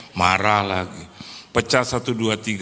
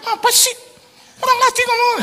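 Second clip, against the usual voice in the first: much higher, mock-whiny young woman; annoyed girl; 3 dB louder than usual. Girl snaps back at her trainer, high and whiny. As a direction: squeak it like an offended girl. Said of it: "marah dia".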